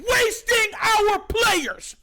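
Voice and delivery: slow, loud exasperated shout; frustrated rant. Real, not acted. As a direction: yell it out, fed up. Fan rant about team.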